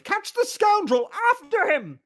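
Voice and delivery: very high, loud protest; exasperated bellow. Acted. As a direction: bellow the correction. Official ordering the chase.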